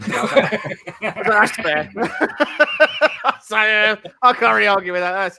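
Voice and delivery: high, loud, laughing concession; helpless with laughter while conceding the point. Real, not acted. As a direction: talk through real laughter.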